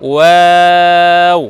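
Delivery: ওয়াও drawn out, lips rounded; teacher modelling the letter slowly. Shown as a demonstration. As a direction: hold ওয়াও long and round. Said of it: "ঠোঁট গোল করব".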